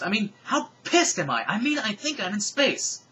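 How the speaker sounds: high, loud incredulous rant; exasperated outburst. Acted. Icarus rants that he is in space, exasperated outburst. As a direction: raise the voice in heated disbelief. Shouting character carries on, exasperated.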